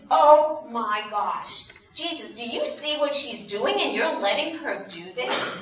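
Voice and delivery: mock shock, stretching the first words; scandalized Pharisee. Simon objecting to Jesus about the woman, scandalized. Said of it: "And Simon says".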